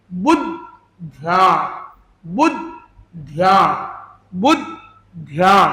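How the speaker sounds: बुद्ध्या slowly as बुद्-ध्या, the द joined to बु; slow drill, linking द to the first syllable of बुद्ध्या; doubled d, slow demo. Shown as a demonstration. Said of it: "बी यू डी, डी को हमने यहाँ जोड़ दिया". (बुद्ध्या धीरे, द को बु से जोड़कर)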